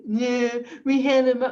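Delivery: loud mock of impaired speech; imitated mocking. Store clerk mocking a customer who struggles to speak, jeering imitation. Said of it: "he started mocking me and he goes".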